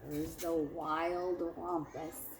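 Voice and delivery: expressive picture-book read-aloud for a class; lively storybook narration; story reading to children. A narrator's reading. Teacher reads picture book aloud.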